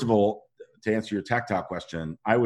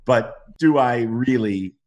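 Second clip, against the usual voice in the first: usual speaking voice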